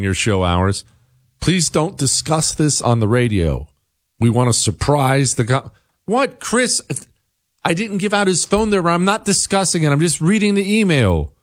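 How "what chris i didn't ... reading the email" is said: high-pitched, indignant self-defence; defensive protest. Real, not acted. Host defends reading the email to Chris, defensive. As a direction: protest your innocence in a raised pitch.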